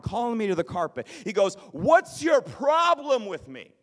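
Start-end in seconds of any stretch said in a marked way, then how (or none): 1.7-3.7 愤怒地高声吼叫; slow, high, loud angry shout. Retold or imitated. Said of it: "He's shouting me down".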